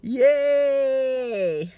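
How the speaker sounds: high, loud mock cheer; sarcastic yay. Real, not acted. Tired worker mock-cheers her twelfth day in a row. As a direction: cheer with heavy irony. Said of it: "my twelfth day in a row".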